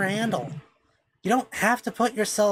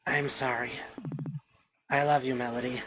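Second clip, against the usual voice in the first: hushed sadness; 6 semitones lower, 6 dB quieter than usual. Acted. Allison leaves Mel in tears.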